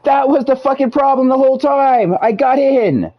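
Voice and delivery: loud, high, triumphant yelling; excited shout. Real, not acted. Finally solved the problem, celebrating.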